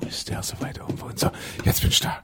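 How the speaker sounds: whispered puffing after climbing stairs; out of breath on the stairs. Acted. [name] climbing the stairs, puffing and cursing in a whisper. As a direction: wheeze and grumble under your breath after three floors. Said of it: "ach so, ich bin die [name]".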